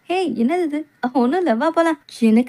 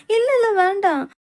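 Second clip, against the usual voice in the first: alarmed protest; 7 semitones higher than usual. Acted. Refuses touch in alarm.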